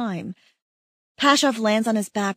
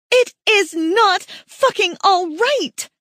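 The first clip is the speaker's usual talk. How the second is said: furious yell; 10 semitones higher, 6 dB louder, slower than usual. Acted. Yelling while slapping him.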